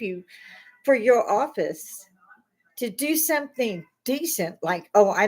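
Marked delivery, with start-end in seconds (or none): none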